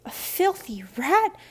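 high, slow, loud revulsion; recoiling from something filthy; disgusted. A narrator's reading. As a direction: draw it out in horrified disgust. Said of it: "recoiling to Barnaby's side of the bench".

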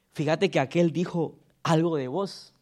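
low, soft gossip; gossiping whisper. Retold or imitated. Pastor mimicking a church gossip, low and soft. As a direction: lean in and pass on the gossip.

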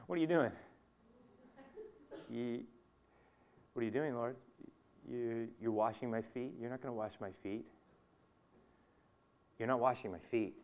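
hesitant, halting, softly spoken protest; quietly thrown, groping for words; caught off guard. Acted. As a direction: stammer softly as if taken aback. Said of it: "I see Peter caught off guard".

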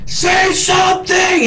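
high, slow, loud mock scream; screamed demand. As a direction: scream it at a patient who won't answer. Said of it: "starts screaming".

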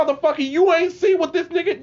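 high, loud, exasperated outburst; indignant retort. Retold or imitated. Student yelling back at an oblivious teacher, high angry protest.

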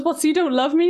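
high-pitched imitation of a wounded girlfriend; mock hurt question. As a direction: act out her alarmed overreaction. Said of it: "'cause she goes".

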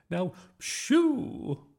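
high, loud, drawn-out command; shooing. A narrator's reading. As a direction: drive the dog off with a screech. Woman shooing the dog away.

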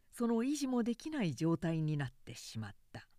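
ordinary delivery, nothing marked

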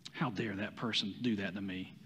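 low, quiet indignant muttering; inner thought, muttered. Pretend. Preacher voicing a listener's hidden offended thought, quiet muttering. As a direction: grumble it under your breath, offended. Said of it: "this is what you're thinking".

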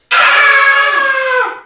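loud, high scream of pain; sudden high-pitched imitated yell. Retells hearing the boy scream. (grito de dolor imitado)

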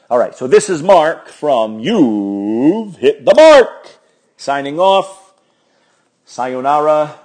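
loud, slow, booming radio host sign-off; announcer sign-off. Acted. Podcast host signing off to listeners, booming announcer voice.

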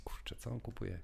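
whispered to himself; a quick whispered aside while trying to remember. Real, not acted. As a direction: whisper it while searching your memory. Trying to recall, aside to self.

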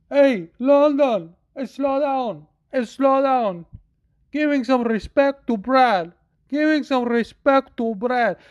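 rally the arena to respect a legend; Chito Vera on the mic. Retold or imitated. Winner on the mic telling the London crowd to honor Brad, calling out. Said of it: "Chito Vera gets on the mic and goes".

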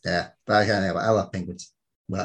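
usual speaking voice

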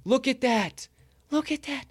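high, excited look at that; thrilled. Real, not acted. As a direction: gush over the art, voice way up. Showing art he is thrilled with.

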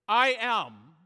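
loud, proclaimed I am; booming declaration. Acted. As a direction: thunder it at the soldiers. Jesus answers soldiers, preacher booms.

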